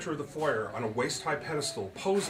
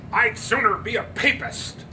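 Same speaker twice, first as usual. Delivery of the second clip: much higher, louder, slow snort; snorting scorn; 11 semitones higher, 9 dB louder than usual. Acted. Andrew to Lizzie, snorting with scorn. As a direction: snort it with contempt. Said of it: "Andrew snorted".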